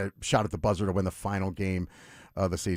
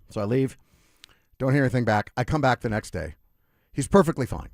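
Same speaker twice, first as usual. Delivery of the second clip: normal talking voice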